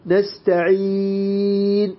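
نستعين held very slowly, lips rounded after the n; long stop, rounded lips. Shown as a demonstration. Quran teacher showing students the long ishmam stop. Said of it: "نضم الشفتين".

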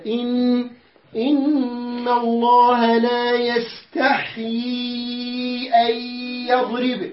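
إن الله chanted slowly, the نون held nasal and doubled; doubled nun with ghunna. Shown as a demonstration. Teacher showing the shadda on nun in a recitation. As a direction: sing it out with a long nasal nun. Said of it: "انتبه على النون مشددة".

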